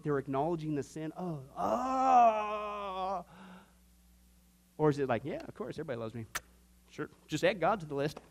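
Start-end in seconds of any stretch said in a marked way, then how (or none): 1.2-3.2 a hammed-up wail of regret; mock anguished groan. Preacher imitating a repentant sinner, hammed-up groaning wail. 5.2-8.2 flippant shrug. Acted. Flippant unrepentant sinner voiced.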